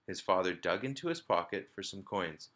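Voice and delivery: ordinary delivery, nothing marked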